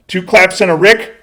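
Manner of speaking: high, loud hype call; rousing catchphrase shout. Pretend. Host asking viewers to comment, rousing high shout. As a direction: holler it out to rally viewers.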